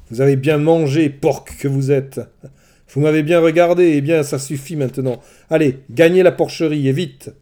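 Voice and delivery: loud, harsh and scornful; a sorceress snapping orders at her guests; hard, cutting voice. Acted. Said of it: "Sa voix devint dure et tranchante".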